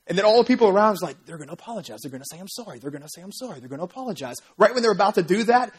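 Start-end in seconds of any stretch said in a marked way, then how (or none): 1.2-4.3 much softer, lower, expectant murmuring; hushed crowd murmur. Retold or imitated. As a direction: lean in and murmur like the crowd waiting.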